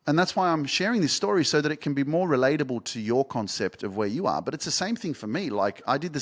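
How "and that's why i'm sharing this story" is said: ordinary talk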